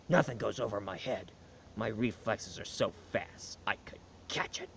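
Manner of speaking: low, hushed, deadly literal delivery; Drax the Destroyer. Acted. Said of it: "Metaphors goes over his head".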